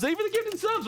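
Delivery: very high excited squeal; squeaky high thanks. Real, not acted. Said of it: "What the heck?".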